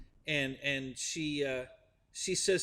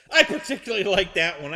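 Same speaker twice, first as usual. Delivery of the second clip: laughing; 5 semitones higher, 8 dB louder, faster than usual. Real, not acted. Laughing after the joke.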